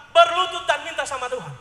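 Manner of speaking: loud preached emphasis on Berlutut. Shown as a demonstration. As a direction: proclaim Berlutut dan minta sama Tuhan. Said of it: "Sekali lagi".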